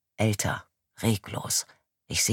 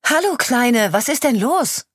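higher and louder, called across the yard; calling from afar; 12 semitones higher, 7 dB louder than usual. Acted. The police chief calling out to a running child from afar. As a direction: reach the child from a distance. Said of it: "rufe ich schon von weitem".

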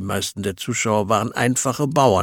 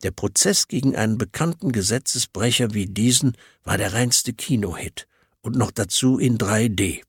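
ordinary delivery

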